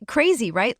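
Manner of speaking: ordinary delivery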